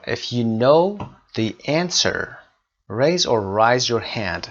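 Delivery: slow, deliberate classroom reading; slow dictation. Shown as a demonstration. Teacher reading the exercise sentence slowly to students. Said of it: "teacher reads exercise slowly".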